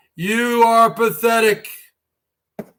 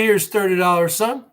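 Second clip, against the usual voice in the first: ordinary talk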